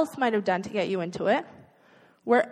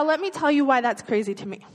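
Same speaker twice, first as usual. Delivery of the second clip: ordinary delivery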